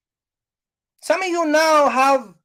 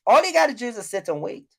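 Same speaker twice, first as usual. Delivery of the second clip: ordinary delivery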